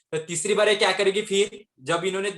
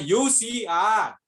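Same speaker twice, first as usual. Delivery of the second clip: stretched, raised mock yell; shouted demo; 5 semitones higher, much slower than usual. Shown as a demonstration. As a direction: call it out slowly to the friend. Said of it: "उसने जोर से चिल्लाई". (खींचकर ज़ोर से चिल्लाना)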